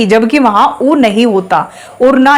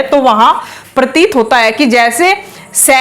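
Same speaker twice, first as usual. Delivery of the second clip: normal talking voice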